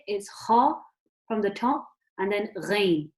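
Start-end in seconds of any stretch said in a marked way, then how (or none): 0.3-0.7 "kha" with a rasping kh from the top of the throat, louder; Arabic kha. Shown as a demonstration. A teacher demonstrates the letter kha, rasping. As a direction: scrape out "kha" from the upper throat. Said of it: "if I'm pronouncing it".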